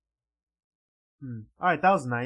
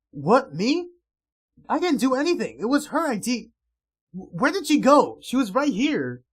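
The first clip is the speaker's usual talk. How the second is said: high, loud panicky denial; loud flustered deflecting; loud protest; 8 semitones higher, 8 dB louder than usual. Acted. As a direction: shout out your innocence. Denying blame for the crash.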